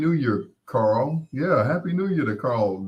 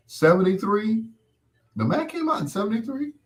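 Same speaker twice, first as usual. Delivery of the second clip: sudden high, quick disbelief; can't believe the date she just read; surprised question; 9 semitones higher, faster than usual. Real, not acted. Reading a chat correction, surprised high disbelief.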